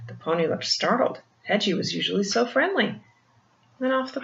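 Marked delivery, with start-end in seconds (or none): none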